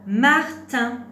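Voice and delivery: loud, high, deliberate model word; word dictation. Shown as a demonstration. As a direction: call out each syllable clearly for a learner. Teacher dictates word for syllable listening.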